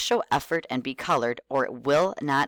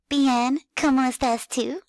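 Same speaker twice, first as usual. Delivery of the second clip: put-on high eager little kid voice; 11 semitones higher, 3 dB louder than usual. Shown as a demonstration. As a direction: squeak it like an excited child. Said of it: "talk very enthusiastically with a kid voice".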